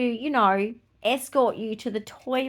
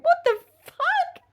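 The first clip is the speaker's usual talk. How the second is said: much higher, a shocked squeak; shocked outburst; 3 dB louder than usual. Retold or imitated. Hearing a boy was scared of her, squeaking in shock. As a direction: blurt it out in disbelief. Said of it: "And I'm like".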